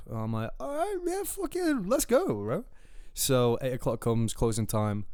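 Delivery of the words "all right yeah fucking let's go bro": very high, squeaky acted-out enthusiasm; hammed-up eager yes; mock excitement. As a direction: jump at the offer in a high voice. Acts out eagerly accepting the invite.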